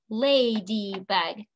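"Ladybug" slowly, in three beats: la-dy-bug; split into syllables. Shown as a demonstration. Teacher stomping with the class, "Ladybug" syllable by syllable. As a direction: separate each syllable of "Ladybug", one per stomp. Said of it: "We stomped three times".

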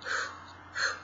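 '呼' breathed out softly, like blowing air; blown hu. Shown as a demonstration. Teacher showing students the soft blowing hu. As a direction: blow out '呼' gently, the lips barely rounded. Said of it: "这样吹风的那种".

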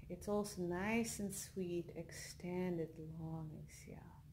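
soft, unhurried meditation voice with long pauses; guided meditation. Acted. Leads a yoga nidra relaxation.

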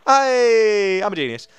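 loud, high, long-held cheer; triumphant cheer. Real, not acted. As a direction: celebrate your own success at full voice. Celebrates climbing out.

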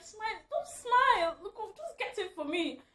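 high mock teasing voice; hammed-up shop strangers; imitating strangers. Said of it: "they're like".

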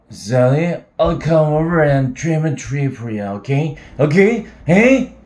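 put-on drunk shouting.

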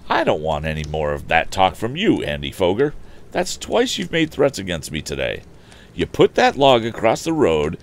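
firm, lightly heated rebuke; determined warning. Acted. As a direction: lay down the law to a bully. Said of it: "burst out the young inventor determinedly".

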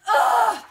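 high, loud "ugh"; disgusted groan. Acted. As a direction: groan in protest at mom.

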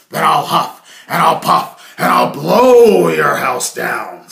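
loud, slow, menacing growl of a threat; threatening wolf. Acted. Wolf threatens to blow down Harold's straw house, loud and slow. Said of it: "said the wolf".

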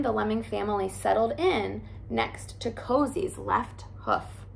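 plain reading voice

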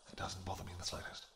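breathy hushed whisper; intimate whisper. Acted. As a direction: lean in and whisper it close. Intimate aside to lover.

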